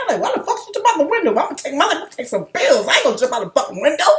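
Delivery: fast, high, shocked inner monologue; incredulous inner voice. Retold or imitated. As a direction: rattle off the disbelieving thought. Retelling own inner reaction.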